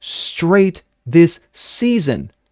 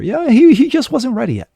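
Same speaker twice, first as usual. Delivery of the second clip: high-pitched mock excuse; mock sympathy; 11 semitones higher than usual. Host sarcastically excusing the athlete, mock sympathy. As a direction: plead his case in a put-on high voice.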